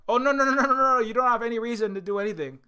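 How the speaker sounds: high, loud, hurried denial; hammed-up "nothing to see here", high and loud; mock innocent reassurance. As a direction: wave it away all innocence. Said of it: "they wanted to tell Britain".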